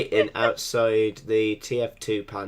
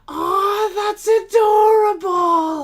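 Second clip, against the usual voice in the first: very high, loud squeal of delight; squealing gush; 10 dB louder, slower than usual. Retold or imitated. Imitating a friend's shocked squeal at something cute. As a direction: shriek it like overwhelmed by cuteness.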